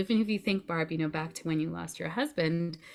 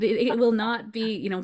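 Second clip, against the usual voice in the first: normal talking voice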